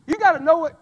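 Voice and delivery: loud, high gotta know it; shouted refrain. Real, not acted. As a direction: drive the point home.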